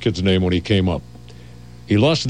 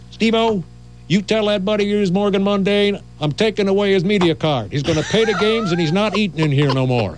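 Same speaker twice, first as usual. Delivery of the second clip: mock angry owner; 10 semitones higher than usual. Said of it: "he says to me".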